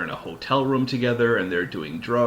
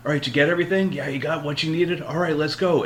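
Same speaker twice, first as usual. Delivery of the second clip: higher, hurried partner checking in; urgent getaway partner; 8 semitones higher, 3 dB louder than usual. Retold or imitated. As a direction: rush him out the door, higher and louder. Pedro Pascal brisk hurry.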